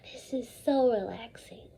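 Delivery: soft whispered sigh of relief. Pretend. Teacher pretending to relax with earbuds, whispering. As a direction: breathe it out as a whisper. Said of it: "now we are gonna act it out".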